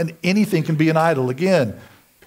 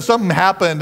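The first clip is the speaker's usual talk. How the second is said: usual speaking voice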